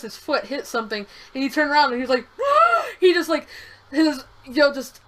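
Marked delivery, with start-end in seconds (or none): 2.3-2.9 sharp high gasp of horror; shocked gasp. Retold or imitated. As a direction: gasp like you just saw what you did. Kid realizes he hurt someone.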